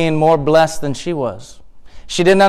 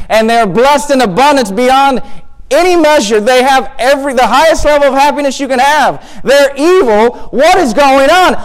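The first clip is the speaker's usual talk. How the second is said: building protest to God; 5 semitones higher than usual. Acted. Asaph telling God the wicked prosper, building exasperation. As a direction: start subdued, climb into exasperated outrage. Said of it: "felt the exact same way".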